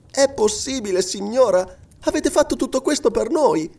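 high-pitched grateful amazement; girl's exclamation. A narrator's reading. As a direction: ask in high, young wonderment. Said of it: "esclamò la giovinetta".

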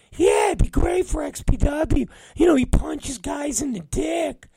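high, eager falsetto impression; mock him pitching a dumb idea in a squeaky voice; Brandon. Coworker pitching a wrestler to his boss, high mocking falsetto. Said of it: "Brandon has a lot of stupid".

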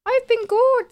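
high, loud, bright-sung reply; chirpy. Real, not acted. Friend answering how she's been, in a high chirpy voice. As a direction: sing it out cheerfully.

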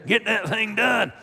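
slow, high, loud Georgia twang; drawn-out Georgia drawl, emphatic; Georgia accent. Pretend. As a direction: lean hard into a Georgia accent. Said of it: "You see my Georgia accent there?".

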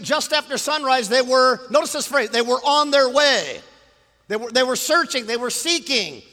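high, loud, emphatic sermon voice; full-force preaching. Real, not acted. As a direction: proclaim it to the whole room.

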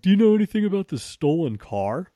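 a cop pressing him about the car; imitating a cop. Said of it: "And they're like".

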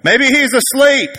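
high, loud, drawn-out mock taunt; taunting trash talk. Elijah mocking the prophets of Baal, high loud taunt. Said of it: "He's talking trash to him".

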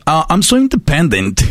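airy mock of a party girl. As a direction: imitate a smug girl bragging. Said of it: "son las que las ves en el antro".

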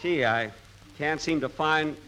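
ordinary talk

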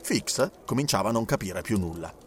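plain reading voice

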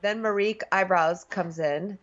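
ordinary talk, nothing marked

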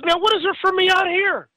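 despairing friend. Retold or imitated. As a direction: imitate a hopeless friend.

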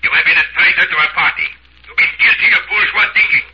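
high, fast furious shouting; angry accusation. Acted. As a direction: denounce him as a traitor, shouting. Party accuses a traitor.

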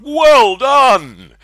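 loud, high cheer; cheering shout. Acted. Crowd cheers Jake.

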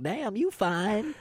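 high, slow, soft flirty appreciation; mock flirtation with drawn-out FINE; admiring catcall. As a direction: stretch it out high, checking out a hottie. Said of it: "Unless you're, like, a hottie".

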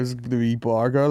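ordinary talk

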